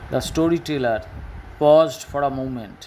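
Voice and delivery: words stretched out; slow reading. Shown as a demonstration. Teacher reading the example sentence slowly to pupils. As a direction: read it slowly so pupils follow.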